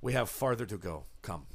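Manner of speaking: low, quick, soft command to follow; a sinister guide hurrying the group on; host's command. Acted. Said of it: "He says".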